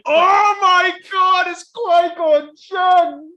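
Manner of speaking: very high, drawn-out excited shout; ecstatic fan shout. Retold or imitated. Fan seeing Qui-Gon turn round, re-enacted as an ecstatic shout. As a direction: shout it slowly in overblown fan amazement.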